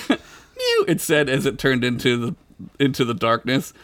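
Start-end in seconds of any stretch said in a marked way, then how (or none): 0.1-0.9 high squeaky kitten mew. Acted. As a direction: mew like a tiny cat. Cat voice in story.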